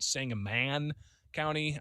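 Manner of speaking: Sangamon said slowly "SANG-uh-MAN"; spelled-out pronunciation. Shown as a demonstration. Host sounding out the county name, spelled-out pronunciation. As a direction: Sangamon ending in a full "man". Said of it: "doesn't pronounce like it's spelled".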